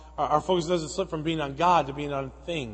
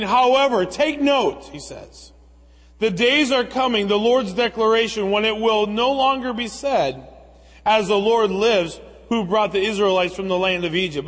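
raised, ringing reading of the prophet's words; scripture reading; 3 semitones higher, 4 dB louder than usual. Acted. Preacher reading scripture aloud to the church, raised and ringing. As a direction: proclaim the verse to the congregation.

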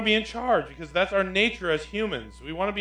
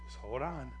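gentle reassurance; 2 semitones lower, 11 dB quieter than usual. Acted. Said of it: "And God is saying".

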